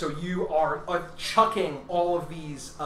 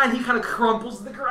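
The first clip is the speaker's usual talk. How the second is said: ordinary delivery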